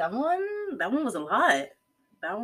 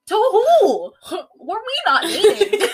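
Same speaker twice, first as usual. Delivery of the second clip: shrill outraged disbelief, much higher and louder, laughing through it; mock outrage; 12 semitones higher, 10 dB louder, slower than usual. Reacting to the breakup text, high loud outraged laugh. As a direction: hit it like she's just learned he's marrying someone else.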